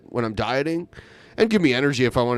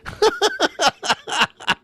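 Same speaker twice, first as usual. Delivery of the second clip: a sudden high-pitched burst of laughter. Real, not acted.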